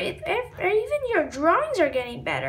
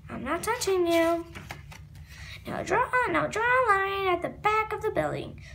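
plain reading voice

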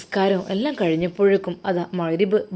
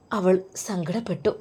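ordinary delivery

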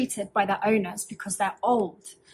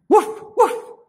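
high, loud yapping bark; sharp excited woofs; barking dog; 11 semitones higher, 8 dB louder than usual. Acted. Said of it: "cried the dog".

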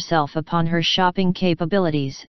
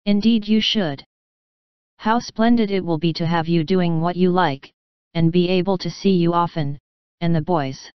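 ordinary delivery

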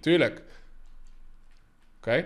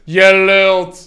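loud disbelief; 2 semitones higher, 12 dB louder than usual. Real, not acted. Disbelief at player name.

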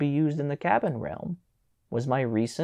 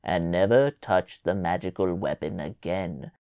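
stern; 7 semitones lower than usual. A narrator's reading. Mother's parting warning.

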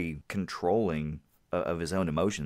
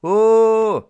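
surprised shout; 15 dB louder than usual. Retold or imitated. Mock Vader line.

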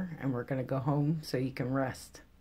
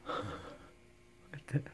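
whispering; 5 semitones lower, 13 dB quieter than usual. Real, not acted. Stifled amused whisper.

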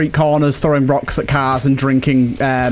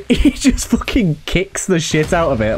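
usual speaking voice

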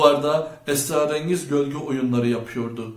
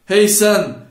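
loud, high angry holler; booming hostile call; thundering; 7 semitones higher, 10 dB louder than usual. A narrator's reading. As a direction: thunder it at someone. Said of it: "diye gürledi Chet".

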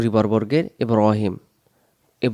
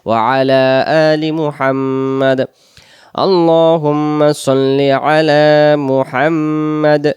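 drawn-out, louder durood recitation; slow recitation; 2 semitones higher, 5 dB louder, slower than usual. Shown as a demonstration. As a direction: recite slowly and clearly. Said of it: "আমরা কেউই ভুল করব না". (ধীর তিলাওয়াত)

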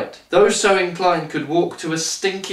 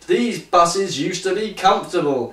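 ordinary talk, nothing marked

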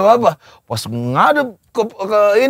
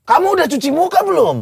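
high-pitched mother's question; 10 semitones higher than usual. Retold or imitated. Mother asks boy about washing, high mock questioning. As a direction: imitate a woman checking on a child in a raised voice. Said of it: "ibunya bilang".

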